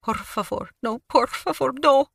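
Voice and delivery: sobbing plea. A narrator's reading. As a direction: beg for your life, voice climbing. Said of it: "Lupita sobbed".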